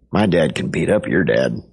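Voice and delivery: low-pitched, lightly acted kid boast; understated schoolboy challenge, pitched low; bragging boy. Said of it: "it's just kids bragging".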